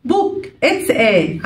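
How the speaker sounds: very slow, word by word; slow reading. Shown as a demonstration. Teacher reading scrambled words, slow. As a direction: read out each scrambled word slowly. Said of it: "عايزة أقول إنه كتاب".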